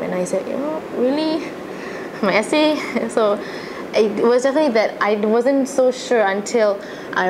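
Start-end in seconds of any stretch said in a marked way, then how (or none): 0.5-2.8 surprised. Retold or imitated. Retold disbelief at teacher's suggestion.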